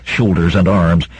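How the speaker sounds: plain reading voice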